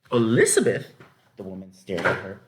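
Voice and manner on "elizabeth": louder surprised question; can't believe her ears; incredulous. A narrator's reading. Farm wife hears the girl's plan, incredulous.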